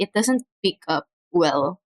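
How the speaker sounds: ordinary talk, nothing marked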